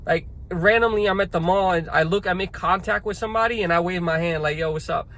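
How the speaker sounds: ordinary talk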